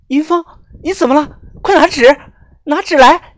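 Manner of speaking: high-pitched frantic cry; panicked yelling. A narrator's reading. Friend shouting for tissues as the narrator's nose bleeds, panicked yelling. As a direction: voice a frightened friend calling urgently for help. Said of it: "大喊大叫着".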